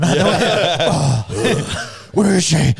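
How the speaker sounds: gruff put-on Batman growl; Batman impression. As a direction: growl it like a caped hero. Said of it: "the waiter was Batman".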